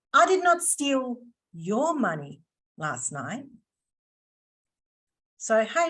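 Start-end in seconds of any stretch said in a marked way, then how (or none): 0.1-3.5 single out "your", pausing around it; stress on YOUR. Shown as a demonstration. Trainer hints it was the company's money, stress on "your". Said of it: "putting the inflection on the word your".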